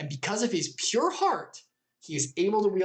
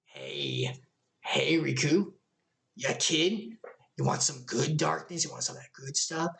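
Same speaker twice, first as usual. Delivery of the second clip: shady tempter; 4 semitones lower than usual. Acted. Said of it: "the brown cloak ... is like".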